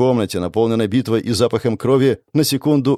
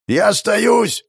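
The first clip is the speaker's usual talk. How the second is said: shouting up; 11 semitones higher, much slower than usual. A narrator's reading. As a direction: shout up to the deck. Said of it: "крикнул он, задрав голову". (кричит)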